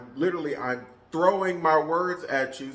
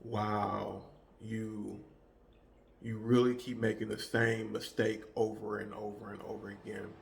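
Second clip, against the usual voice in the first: soft, lower, dripping with sarcasm; sarcastic tone; 5 semitones lower, 4 dB quieter than usual. Shown as a demonstration. Showing the boss a sarcastic tone to a coworker. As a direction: let the contempt sit in a quiet voice. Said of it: "an example of a tone".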